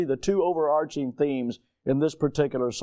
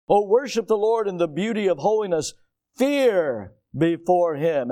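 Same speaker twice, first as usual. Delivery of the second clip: scripture reading; 3 semitones higher than usual. Acted. Reading psalm aloud.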